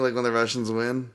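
usual speaking voice

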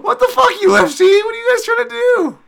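loud, very high-pitched yelling; outraged shout. Acted. Fan scolding UFC matchmakers, outraged shout. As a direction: yell at them in shrieking disbelief.

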